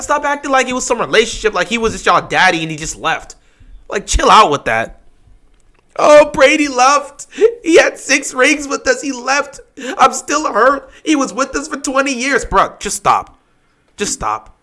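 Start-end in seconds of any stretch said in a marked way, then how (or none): 6.0-12.4 mock hurt fan. Mocking a fan still hurt that Brady left. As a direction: pout like a heartbroken fan.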